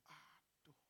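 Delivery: hushed, breathed-out groan; whispered sigh. Retold or imitated. Preacher reliving hearing of nine years' prayer, whispered sigh. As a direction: let it out under your breath.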